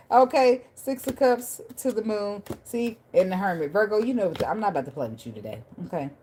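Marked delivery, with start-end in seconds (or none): none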